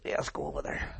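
hushed, breathy whisper. Retold or imitated. Joab whispering to the soldier about going over. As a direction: drop to a secret whisper. Joab voiced in the retold story.

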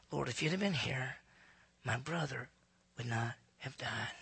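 quiet retold plea, sinking at the end; hushed lament. Grieving sister speaks at Jesus' feet, hushed.

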